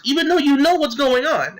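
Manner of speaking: rising, incredulous accusation; indignant challenge. Retold or imitated. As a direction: pitch jumps high in outrage. Indignant retold challenge to leader.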